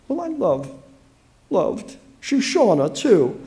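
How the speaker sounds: high, halting, near tears; choked up. A narrator's reading. Allison mourning her pet, high and choked up. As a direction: stumble on the words as grief catches you. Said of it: "She choked up".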